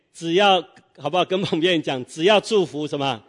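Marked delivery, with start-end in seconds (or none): none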